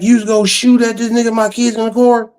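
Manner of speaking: high-pitched mock outrage; mad mother. Mad mother scolding over the pistol, high mock outrage. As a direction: imitate her scolding in a high voice. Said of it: "she got mad at me".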